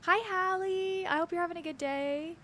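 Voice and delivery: beam it out to a friend; bright greeting. Real, not acted. Greeting a chat member.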